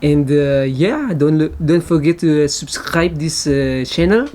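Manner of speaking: English spoken with a light French accent; lightly put-on French accent in English. Shown as a demonstration. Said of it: "Par exemple, je peux utiliser accent français". (un léger accent français en anglais)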